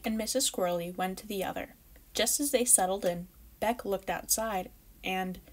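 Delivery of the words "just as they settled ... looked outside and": ordinary delivery, nothing marked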